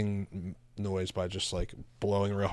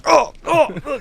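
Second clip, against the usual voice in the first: high startled shrieks; shocked shriek; 15 dB louder, slower than usual. Retold or imitated. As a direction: shriek like she's been spat on. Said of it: "And she was like".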